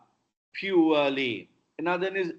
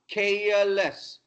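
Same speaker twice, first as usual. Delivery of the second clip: ordinary delivery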